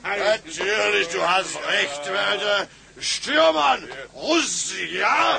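put-on boisterous drunk calling out. Drunk captain laughing, loud.

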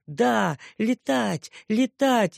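put-on high, joyful childish cheer. A narrator's reading. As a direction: cheer like a happy toddler. (радостный детский возглас)

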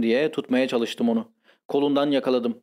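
plain reading voice

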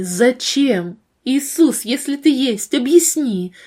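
anguished questions, swelling in force; desperate pleading. Acted. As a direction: cry out to heaven demanding an answer. Desperate questioning prayer to God.

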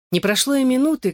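ordinary delivery, nothing marked

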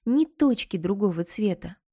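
usual speaking voice

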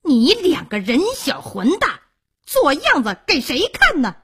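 high, loud stepmother's rebuke; angry scolding. A narrator's reading. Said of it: "骂道". (恶狠狠地高声责骂)

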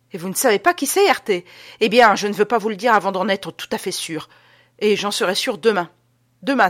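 parler haut et fort, avec autorité; annonce exaltée. Acted. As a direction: donner ses consignes avec fièvre. Adolphe annonce son plan avec insistance. (insistance théâtrale et sonore)

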